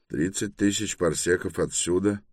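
plain reading voice

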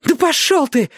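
high, spiteful female voice; defiant young woman. A narrator's reading. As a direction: hurl it back at him. Girl defies him.

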